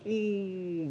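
soft, high drawn-out ooh; dismayed "ooh". Retold or imitated. Students realizing what had happened, hushed dismayed ooh. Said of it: "realizing what had happened".

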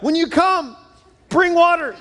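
high, loud cheeky call with a laugh; kid yelling back. Acted. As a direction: fire back the punchline from bed. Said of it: "the boy yells back".

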